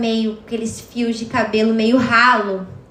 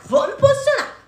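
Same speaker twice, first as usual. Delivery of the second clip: rising resolve; 14 semitones higher than usual. Retold or imitated. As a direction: repeat "posicionar" far higher, determined. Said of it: "Eu falei". (repetindo "posicionar" bem mais agudo, decidida)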